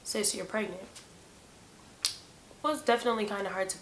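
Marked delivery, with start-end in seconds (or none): 0.0-0.8 soft note to self; subdued inner pep talk; mental self-talk. Retold or imitated. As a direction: break it to yourself quietly. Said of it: "trying to mentally tell myself".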